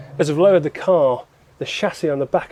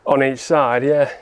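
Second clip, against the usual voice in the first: ordinary talk, nothing marked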